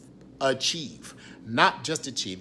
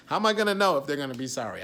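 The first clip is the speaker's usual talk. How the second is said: fast, louder, half-laughing; amused rapid question; 4 semitones higher, 7 dB louder, much faster than usual. Real, not acted. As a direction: rattle it off, amused at himself. Laughing at his own logic.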